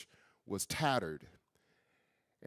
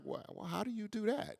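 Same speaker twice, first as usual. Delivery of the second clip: a much higher, softer mock-baffled voice; baffled question; 10 semitones higher, 7 dB quieter than usual. Author asks friends how to write from the heart, mock-baffled and high. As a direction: play it lost and puzzled.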